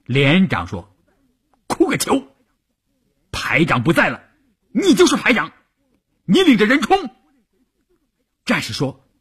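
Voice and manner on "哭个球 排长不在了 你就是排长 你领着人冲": high-pitched, urgent battlefield command; gruff scolding order. A narrator's reading. Company commander to a weeping soldier, scolding urgently. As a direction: scold him and order him forward.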